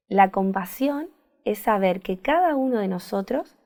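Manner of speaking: ordinary talk, nothing marked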